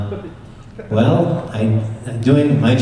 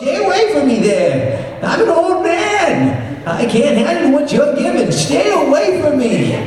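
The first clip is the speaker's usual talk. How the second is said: put-on much higher cackling old-man voice; Walter Brennan impression. Answering the Duke, an old sidekick cries out high and cackling. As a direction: play a giddy old cowboy cook yelling. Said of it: "Hey, Walter Brennan".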